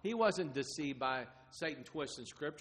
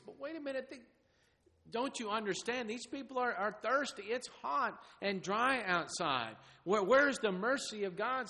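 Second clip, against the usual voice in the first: pleading plea; 7 semitones higher than usual. Acted. Pleading on behalf of the thirsty.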